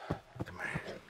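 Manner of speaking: hoarse whispered call; whispering. Real, not acted. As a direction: whisper it, too sick to talk. Whispered, sick.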